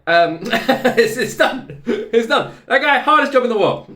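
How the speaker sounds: high, loud, laughing through the words; giddy fan laughter; laughing excitedly. Real, not acted. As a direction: giggle while you rave. Laughing while raving.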